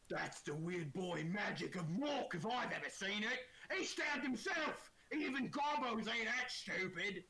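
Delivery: high, loud, drawn-out orc voice; orc stage whisper. Acted. Orcs remarking to each other on the goblin, loud orc stage whisper. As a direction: gossip as an orc, pitched high and far from hushed. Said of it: "you hear a couple of them whispering".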